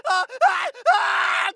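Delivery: long, slow high scream of pain; drawn-out agonised wail; dying cry. Acted. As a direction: cry out as you go down.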